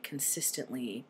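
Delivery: ordinary delivery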